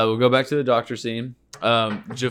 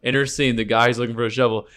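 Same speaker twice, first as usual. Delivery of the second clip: ordinary talk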